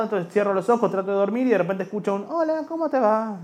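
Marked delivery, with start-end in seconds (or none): 2.3-3.4 put-on much higher, slow childlike voice; child voice, high and slow; inner child. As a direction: greet like an eight-year-old boy. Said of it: "es mi niño interior".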